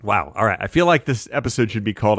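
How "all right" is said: usual speaking voice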